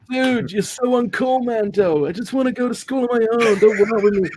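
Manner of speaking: mock a moody goth teen; whiny teen voice. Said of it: "And he's like".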